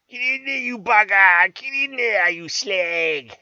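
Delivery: loud, high Cockney yell; loud high Cockney holler; Cockney shout. Acted. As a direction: bellow it like a Cockney. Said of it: "In proper Cockney fashion".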